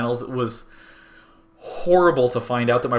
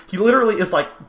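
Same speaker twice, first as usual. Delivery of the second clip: ordinary talk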